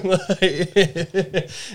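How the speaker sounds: loud high burst of laughter. Real, not acted. Laughing after the impression.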